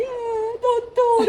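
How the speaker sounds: high, breaking cry of relief and fear; reliving the moment she broke down in tears. Retold or imitated. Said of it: "Eu comecei a chorar ali".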